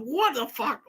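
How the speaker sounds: high-pitched baffled outburst; retold exasperation. Teller tormented by a brainworm, high exasperated disbelief. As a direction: replay being driven mad by it.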